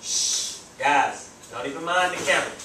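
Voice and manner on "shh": long voiceless shush. Real, not acted. Teacher hushing students arguing over the camera, shushing. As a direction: hush the room with a shh.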